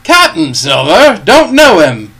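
loud questioning, climbing high; captain's scornful cry. A narrator's reading. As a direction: call back as if the name means nothing. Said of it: "cried the captain".

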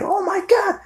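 shrieking high-pitched scream; mock startled scream; retold scream. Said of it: "and she screamed".

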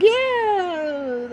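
high, drawn-out hooray; mock cheering. Democrats celebrating the ousting, mock glee. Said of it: "Democrats are like".

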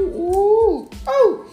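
high, loud frustrated "ooh"; worked-up ooh. Acted. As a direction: let out rising, pent-up oohs. Worked up, imagining a fight.

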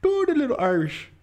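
high, put-on Irish lilt; mock Irish accent. Friend requesting an impression, in mock Irish accent. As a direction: pitch it up in playful Irish. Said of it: "a little bit of Irish".

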